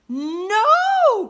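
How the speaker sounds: long, high, loud no; drawn-out storybook cry of refusal; crying out in protest. A narrator's reading. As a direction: wail a protest as upset kids. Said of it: "cried Lucy and Sam".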